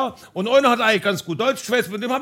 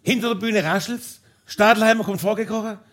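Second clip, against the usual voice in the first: ordinary delivery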